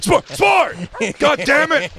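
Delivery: high loud mock angry yell. Owner cursing as the dog runs off. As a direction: bellow after the dog. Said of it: "owner yelling at runaway dog".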